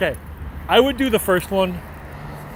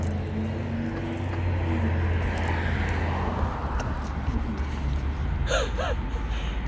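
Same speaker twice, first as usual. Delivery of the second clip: long, breathless, silent wheeze of laughter; helpless unvoiced giggle fit; real high breathy laugh, no voice; real wheezing laugh; 6 semitones higher, 8 dB quieter than usual. Real, not acted. Real laughter after street chaos.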